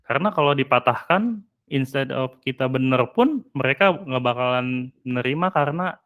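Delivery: ordinary delivery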